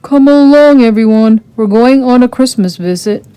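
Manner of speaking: rally everyone cheerfully; calling out. A narrator's reading. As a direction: prince calling the household together, loud. Eric calls the household.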